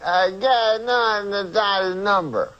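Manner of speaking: high, slow Cosby voice; drawn-out high Cosby voice; Cosby-like agent voice. Retold or imitated. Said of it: "you start to talk like him".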